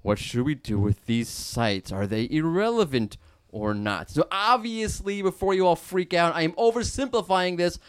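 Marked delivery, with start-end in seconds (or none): 0.0-4.1 musing machine voice. Pretend. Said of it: "And machine learning says".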